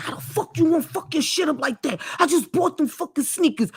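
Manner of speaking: hammed-up angry father; imitating angry dad. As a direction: act out the dad cursing his kid. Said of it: "is cussing this four-year-old like".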